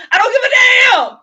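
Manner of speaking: high, loud, near-sobbing yell; furious crying shout. Retold or imitated. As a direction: scream it out, at the breaking point. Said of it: "But it wasn't one of them".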